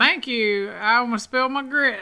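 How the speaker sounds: put-on Southern accent parody about grits; mock Southern lady. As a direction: tease her with a Southern accent.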